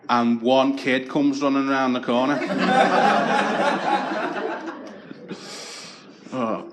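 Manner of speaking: ordinary talk, nothing marked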